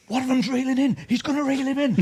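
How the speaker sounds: mate bursting in with the news; excited call. Retold or imitated.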